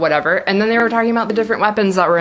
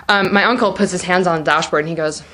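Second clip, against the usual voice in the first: normal talking voice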